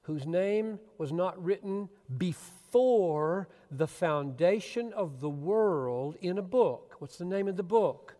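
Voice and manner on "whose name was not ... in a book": slow, deliberate, every word weighted; over enunciating. Shown as a demonstration. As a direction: spell it out slowly and loudly. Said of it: "Let me say it more slowly".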